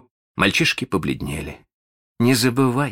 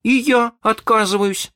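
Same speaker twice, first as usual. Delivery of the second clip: high-pitched echo of defiance; boy chiming in; 4 dB louder than usual. Acted. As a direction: join the refusal in a small boy's high voice. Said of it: "подхватил Майкл".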